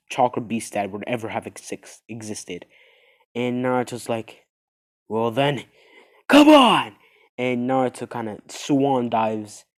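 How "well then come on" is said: rising to a big shout; from steady to a full shout; battle cry. Acted. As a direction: yell it out as a dare to the monsters. Naruto challenging the monsters.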